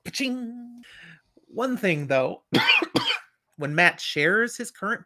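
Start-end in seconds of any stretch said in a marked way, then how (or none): 0.1-0.8 high, drawn-out "ching"; vocal sound effect, pitched up; imitated ringing chime. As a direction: make a bright coin-like ring with your voice.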